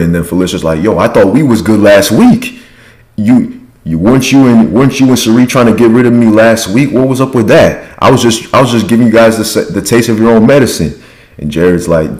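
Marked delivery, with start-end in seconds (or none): 0.8-11.0 higher-pitched, heated mock retort; hammed-up accusing, pitched up; acted confrontation. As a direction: imitate an indignant comeback in an argument.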